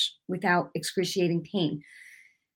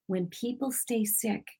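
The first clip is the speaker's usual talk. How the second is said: hushed quote; 2 semitones higher, 10 dB quieter than usual. Retold or imitated. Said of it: "when he said those words".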